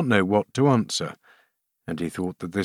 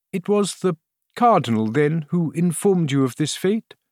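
anxious queen; 8 semitones higher than usual. A narrator's reading.

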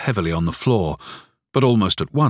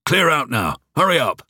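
higher mock angry shout; shouted angrily; 8 semitones higher than usual. A narrator's reading. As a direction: bark it at the family. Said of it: "The latter shouted angrily".

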